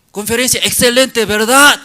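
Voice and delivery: high, loud hammed-up MC hyping the crowd; overbearing master of ceremonies, shouted high; pushy presenter. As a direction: play the host forcing applause.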